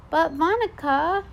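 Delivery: put-on little kid calling. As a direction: pipe up like a curious toddler.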